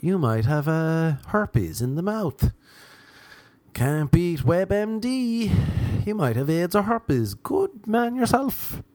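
high, slow chirpy voice; chirpy WebMD voice telling him he has herpes; mock cheery diagnosis. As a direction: sell the scary news like good news.

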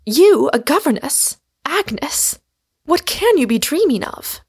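high-pitched, incredulous sister; astonished exclamation. A narrator's reading. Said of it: "My sister dropped her work in astonishment, exclaiming".

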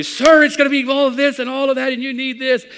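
high, loud Italian-tinged call; calling salesman. Retold or imitated. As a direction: shout after the passing preacher. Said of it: "He kept calling out to me".

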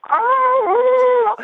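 long, very high, loud mock scream; retold scream. Patient being squeezed from the inside, re-enacted agonized scream. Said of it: "And I'm screaming".

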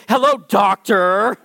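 slow, drawn-out doctor, as if doubting the title; mock scare quotes. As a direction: stretch out doctor in sarcastic quotation marks. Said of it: "putting pastor in quotation marks".

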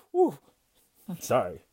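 exhale, then apologize haltingly; real choked-up apology with long pauses; overcome with emotion. Real, not acted. Expectant father apologizes to interviewers for tearing up, emotional. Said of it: "did not think I was gonna get like this".

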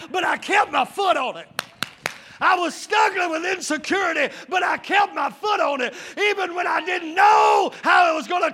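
loud, high, shouted sermon cadence; full-force preaching. Real, not acted. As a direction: fire up the congregation with shouted, driving phrases.

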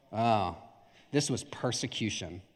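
low, hushed woe-is-me murmur; mock martyr. Preacher mimics driver blaming persecution, mock martyr.

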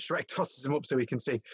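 normal talking voice

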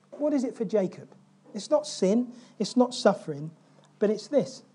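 ordinary talk

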